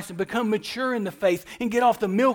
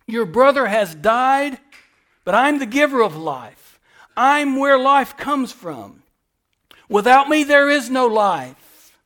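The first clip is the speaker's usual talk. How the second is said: louder, slow, building preacher's proclamation; full-force preaching; 3 semitones higher, 7 dB louder, slower than usual. Acted. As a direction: thunder it out to the congregation. Preaching Jesus's words at force.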